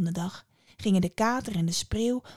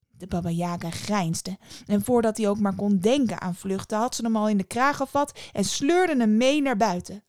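ordinary delivery

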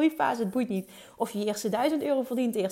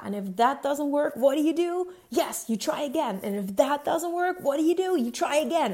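high, loud seminar shout; Tony Robbins shouting; 5 semitones higher, 4 dB louder than usual. Retold or imitated. Quoting a speaker yelling at his audience, loud and high. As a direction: hammer it at the crowd like a stage coach. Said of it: "Dat is wat hij schreeuwde toen".

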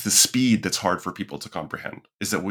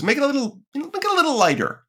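high, mocking client voice, much higher; fussy client; faster than usual. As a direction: mimic a picky client asking for tweaks. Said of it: "somebody goes".

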